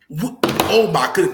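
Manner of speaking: ordinary talk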